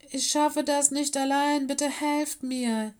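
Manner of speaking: high, plaintive whimper; wailing plea, dragging out the end; whining. A narrator's reading. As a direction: beg pitifully for help. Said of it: "jammerte Bruce".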